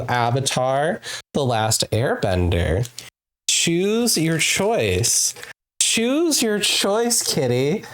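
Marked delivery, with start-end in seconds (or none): 3.5-7.2 slow, stretched teasing; sing-song slow choose your choice; slow drawn out. Acted. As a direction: drag every word out playfully. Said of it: "drawn-out repeat".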